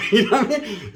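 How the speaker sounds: bursting into laughter on the punchline; laughing at own joke. Real, not acted. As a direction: crack up as you land it.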